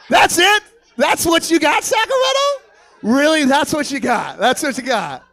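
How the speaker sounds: loud, high outraged disbelief; incredulous shouting. Acted. Comic berating Sacramento over a lazy robbery, incredulous shout. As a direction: yell it like you feel cheated.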